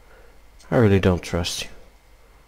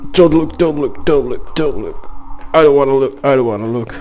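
higher, louder, fading repeated plea; panicked pleading; 5 semitones higher, 5 dB louder, faster than usual. Real, not acted. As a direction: beg yourself not to look. Panicked chant while scared.

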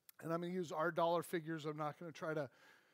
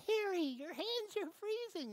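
much higher strangled voice; choked high squeak; 14 semitones higher than usual. Acted. Man choked by the servant, squeaking in a strangled high voice. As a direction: squeak like being throttled.

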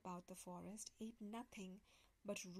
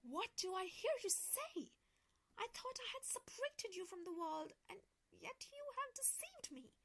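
much higher, indignant enchantress voice; angry witch; 10 semitones higher than usual. Acted. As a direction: scold the betrayal, pitch climbing. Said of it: "cried the enchantress".

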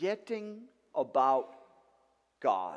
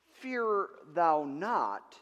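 slow solemn quote; 4 dB louder, much slower than usual. Acted. Quoting God's command slowly.

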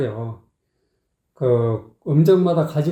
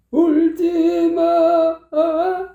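high, slow mocking sung imitation; mock the other singer's sloppy phrasing; imitating a wrong take; much slower than usual. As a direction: parody the singer's stretched 아. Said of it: "이렇게 하고 있다고".

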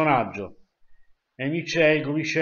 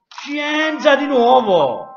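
showy game show host; 4 semitones higher, 6 dB louder, much slower than usual. Pretend. Announcing quiz category.

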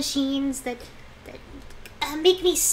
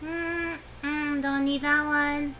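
ordinary talk, nothing marked

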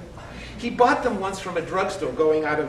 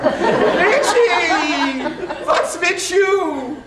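very high, loud and slow; hammed-up high aunt voice; aunt's voice; 12 semitones higher, 5 dB louder, much slower than usual. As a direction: stretch it high like a doting aunt. Aunt squeezing boy in hug.

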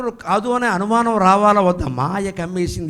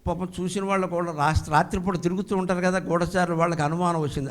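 usual speaking voice

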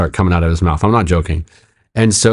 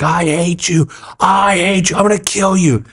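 imitated angry threat; 10 semitones higher than usual. Pastor imitating the man pinned to his door, high angry threat. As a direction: play a pinned man's high-pitched raging threat.

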